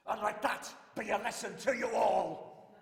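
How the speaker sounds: loud stern public proclamation; town crier warning. Shown as a demonstration. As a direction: boom it out as a warning. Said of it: "That was a court announcement".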